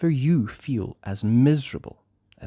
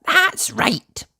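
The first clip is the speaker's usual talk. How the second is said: much higher, eager townswoman; lady's voice; 3 dB louder than usual. Acted. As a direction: chirp it like the laundry lady. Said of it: "said Missus Washer".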